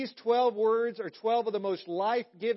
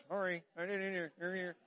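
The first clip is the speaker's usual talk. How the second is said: softer, mumbled through closed lips; lips not moving; 8 dB quieter than usual. Retold or imitated. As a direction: say it like a ventriloquist, mouth still. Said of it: "If your lips aren't moving".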